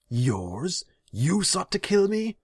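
very high, loud disbelief; incredulous shouted question; shocked outrage. A narrator's reading. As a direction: leap up in pitch, appalled. Shocked ruler questions demon.

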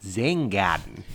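slow, mocking New Zealand accent. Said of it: "nice and slowly in a New Zealand accent".

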